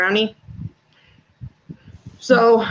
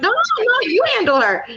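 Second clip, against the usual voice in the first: much higher, protesting daughter voice; imitating daughter; 12 semitones higher than usual. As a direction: play the daughter pushing back, pitched way up. Daughter refusing to confront mom.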